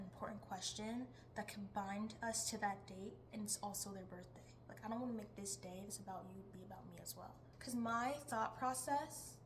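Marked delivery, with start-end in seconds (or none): 4.7-7.3 whispered aside. Real, not acted.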